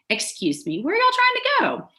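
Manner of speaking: put-on Southern accent, hitting hard on the y'all; friendly Southern accent with a heavy "y'all"; turned-up Southern accent. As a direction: lay on the Southern accent, pitch climbing into the question. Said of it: "turning up my accent and hitting hard on the y'all".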